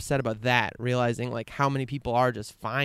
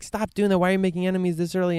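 higher, faster, pushing hard to stop them; rushed exasperated pleading to a friend; mock urgent scolding; retold urgent plea; 7 semitones higher, 4 dB louder, faster than usual. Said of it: "And me being like".

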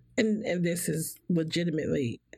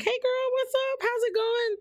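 high, bubbly party greeting. Retold or imitated. Greeting friends as the party girl, high and bubbly. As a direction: chirp it like the life of the party. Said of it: "I'm like".

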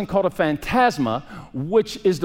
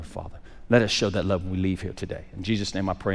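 lower, earnest prayer voice; 9 semitones lower than usual. Real, not acted. Pastor praying for the congregation, earnest. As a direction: pray over the church with conviction.

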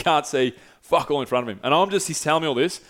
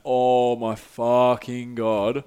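very slow, drawn-out exclamation; emphatic amazement; much slower than usual. Real, not acted. Storyteller recalls his hardest laugh, slow emphatic exclamation. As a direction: stretch out each word for emphasis. Reacting to the phone-torch reveal.

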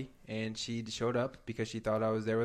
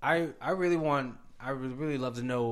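normal talking voice

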